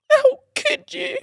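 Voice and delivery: hammed-up fake crying, way up high and laughing; mock wail. Boyfriend fake-sobbing at the girlfriend who left him behind, hammed-up. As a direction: wail it like a betrayed lover, tongue in cheek.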